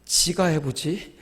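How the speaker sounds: slow, soft inner grumble; quiet sarcastic aside to herself; muttered to herself. Retold or imitated. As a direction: grumble it under your breath. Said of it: "속으로".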